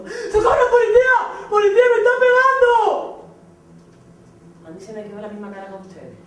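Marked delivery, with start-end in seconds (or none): 0.0-3.2 shrill, very high, loud mock scream; fake cry for help. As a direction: ham up a victim's yell for the police. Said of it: "se tiró al suelo y empezó".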